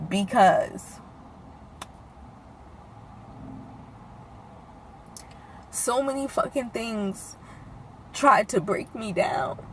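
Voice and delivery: quiet, halting, fighting back tears; pausing long to keep from crying; holding back tears. Real, not acted. To viewers about her mother, holding back real tears. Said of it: "and I'm trying not to cry".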